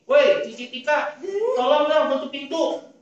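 loud, high, bossy yell; high-toned, loud scolding. Shown as a demonstration. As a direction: demonstrate a rude shouted order. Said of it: "nadanya tinggi, ngomongnya kenceng".

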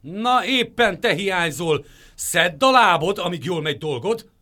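loud, high angry shout; fiery anger. A narrator's reading. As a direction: chase him off furiously. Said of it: "tüzes haragra gerjedtek".

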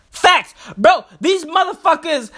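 ordinary talk, nothing marked

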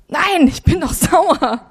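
brushing off the Holy Spirit, sulking; sulky refusal. Retold or imitated. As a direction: sulk like a stubborn child.

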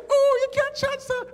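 put-on very high, loud Jamaican-girl exclamation; mock falsetto outburst with Jamaican lilt. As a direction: imitate a Jamaican girl teasing. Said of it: "she said".

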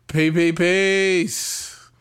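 very slow, stretched catchphrase; drawn-out sign-off. Real, not acted. Podcaster signing off to listeners, drawn-out. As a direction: stretch every word out long.

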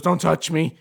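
aggressive snap. Retold or imitated. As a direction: snap at everyone to back off.

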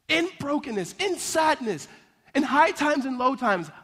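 high-pitched, building sermon cadence; emphatic pulpit list, voice lifted; impassioned preaching. Real, not acted. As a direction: preach each phrase with mounting conviction. Preaches toward the climax.

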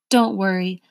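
ordinary delivery

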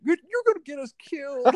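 high, loud mock alarm; imitating Mike panicking. Friend mocking passenger Mike's driving panic, high loud alarm. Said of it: "I was like".

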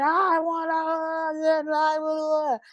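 high-pitched wailing toddler imitation; mock tantrum wail, words dissolving into blubber; crying child tantrum. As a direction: mimic a small child's tearful whining. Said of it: "'cause she's crying, she's like".

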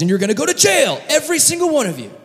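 rising high, quick acted threat; hammed-up angry governor; mock outburst. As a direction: lay down the sentence, climbing in pitch. Joseph sentences brothers to jail.

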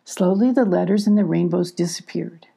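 ordinary delivery, nothing marked

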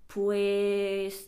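slow, drawn out, stalling; buy time with a long filler; stretched pues. Shown as a demonstration. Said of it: "めちゃめちゃ伸ばしちゃうんですよ".

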